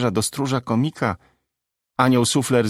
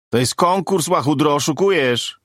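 raised, higher heckler's cry; indignant heckle, pitched up; calling out; 6 semitones higher, 3 dB louder than usual. Acted. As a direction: call out an accusation from the crowd. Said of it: "zawołał anioł blondynki".

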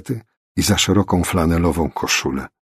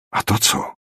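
hushed, nearly whispered question; sudden whispery self-talk; asking oneself. A narrator's reading. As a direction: wonder under your breath. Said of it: "zapytała nagle sama siebie".

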